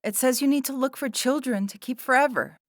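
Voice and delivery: very high, insistent protest; incredulous bawl. A narrator's reading. Sarah reports what the journal says, bawling incredulously. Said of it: "bawled Sarah incredulously".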